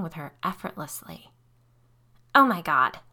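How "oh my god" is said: loud, tense hissed exclamation. A narrator's reading. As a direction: hiss it out in shock, pushed louder. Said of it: "she hissed".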